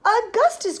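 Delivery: high, loud call of a name; crying out. A narrator's reading. Father calling his son from the river, crying out. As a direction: call sharply after him. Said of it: "cried Mister Gloop".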